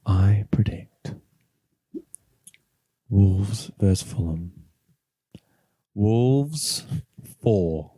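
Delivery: slow, mystical fortune-teller delivery; mock-solemn prophecy; predicting voice. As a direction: intone it with long dramatic pauses. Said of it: "Should I put on my predicting voice?".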